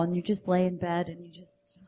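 breathy hushed whisper. Shown as a demonstration. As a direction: lean in and whisper it. Seductive bedroom tip, whispered.

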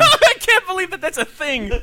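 high, squeaky laugh through the words; laughing disbelief. Real, not acted. Host reacts to adults playing Pokemon, laughing in disbelief. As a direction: crack up in amazement.

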